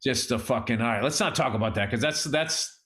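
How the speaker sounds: low, heavy-hearted voice; subdued sadness. Real, not acted. Man remembering his friend who passed away, subdued sadness. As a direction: drop low and hold the grief back.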